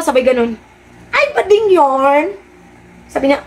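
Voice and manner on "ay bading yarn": camp mockery.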